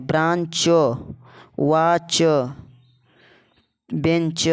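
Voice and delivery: "ब्रांच", "वाच", "बेंच", each ending in a separated "च"; released ch endings. Shown as a demonstration. Teacher listing ch-sound words, "ब्रांच", "वाच", "बेंच" with distinct ch. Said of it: "यहाँ पर च का sound आ रहा है".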